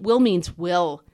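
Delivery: WILL hit loud and slow; loud, drawn-out emphasis on WILL; stress on WILL. Real, not acted. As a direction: punch each "will" with force. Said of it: "put emphasis on that word will".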